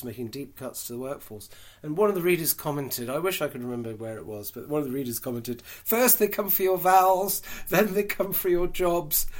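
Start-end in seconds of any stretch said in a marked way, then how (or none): 5.6-9.3 high, loud, slow declamation; hammed-up, measured punchline delivery; mock-solemn quote. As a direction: proclaim it like a dire warning, for laughs. Delivering a reader's joke comment.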